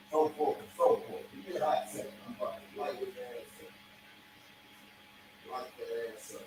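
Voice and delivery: whisper. Real, not acted. Whispering away from mic.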